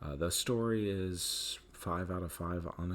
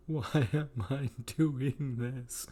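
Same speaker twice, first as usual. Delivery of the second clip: exasperated groan; 8 semitones higher, 7 dB louder, much slower than usual. Retold or imitated. Mock bored self quote.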